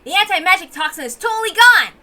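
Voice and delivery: high, loud, gleeful shout; bubbling over, calling everyone inside; excited call. Acted. Girl calling the whole crowd into the party, high excited shout. Cheerful character calls everyone in.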